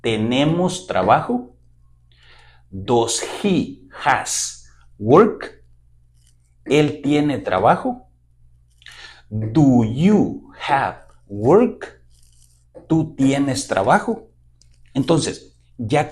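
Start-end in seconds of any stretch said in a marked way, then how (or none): 2.7-5.6 Does he has work drawn out slowly for the class to echo; slow choral-reading prompt; slow repeat-after-me. Shown as a demonstration. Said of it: "repítalo usted conmigo". 9.3-12.0 slow, clear model for learners to copy; slow repeat-after-me. Shown as a demonstration. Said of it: "repítalo usted conmigo".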